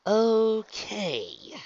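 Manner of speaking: high, loud, drawn-out doubt; puzzled okay. Acted. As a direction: stretch the word out, unconvinced. Twilight's puzzled reply to the babbling.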